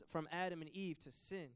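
ordinary talk, nothing marked

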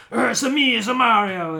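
gruff New Yorker Mario, loud; [name]. Acted. Imitating the old cartoon Mario, gruff and loud. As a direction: growl it out like a rough New Yorker. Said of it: "he was the gruff New Yorker".

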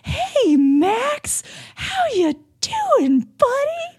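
put-on very high, sing-song adult-to-child voice; fake cheery kid voice. Mocking a dad greeting his son Max, high sing-song. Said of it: "You can't condition children to speak to".

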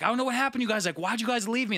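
hint of an upset friend complaining; hurt friend. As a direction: play the abandoned friend, a touch whiny. Friend voiced, mad at being left.

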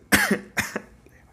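loud harsh coughing; coughing fit. Real, not acted. Comic breaks off mid-story, real coughing fit. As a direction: cough hard into the mic. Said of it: "I'm sorry".

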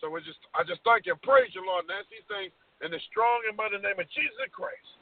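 normal talking voice

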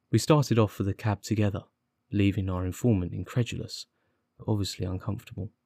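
ordinary delivery, nothing marked

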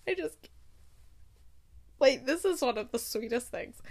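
breathy, dreamy gush; swooning sigh. Real, not acted. Vlogger gushing about a romantic line, swooning. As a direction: melt over the sweet line. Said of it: "swooning sigh".

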